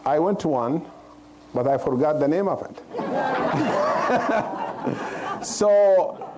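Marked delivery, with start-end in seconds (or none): 2.9-5.4 short breathy laugh at his own joke; airy chuckle at himself. Real, not acted. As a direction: laugh off the joke about forgetting.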